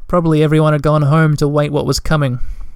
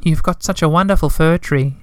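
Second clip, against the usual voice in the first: timid little creature; 5 semitones higher than usual. Acted. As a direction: ask shyly, afraid to intrude. Tiny timid creature asking.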